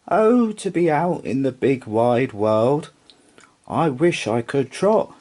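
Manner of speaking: high, longing little horse; wistful horse voice. Acted. Rocking horse longing to trot, high and wistful. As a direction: sigh out the wish high.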